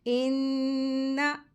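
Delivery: इन्ना with a held, nasal ghunna on the doubled nun, no jerk; Quranic recitation. Shown as a demonstration. Tajweed teacher shows students how to recite इन्ना. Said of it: "तो कैसे पढ़ेंगे?".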